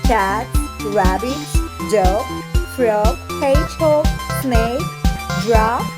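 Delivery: usual speaking voice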